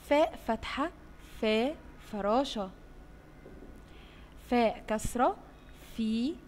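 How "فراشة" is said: ordinary delivery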